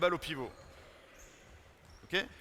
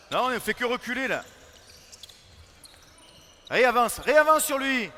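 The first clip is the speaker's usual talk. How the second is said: shouting instructions; 8 semitones higher, 12 dB louder than usual. Real, not acted. Coach yelling at retreating defenders.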